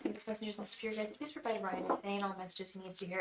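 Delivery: quiet, repeated ritual chant; soft invocation. Acted. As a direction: call on the angels softly, like a prayer.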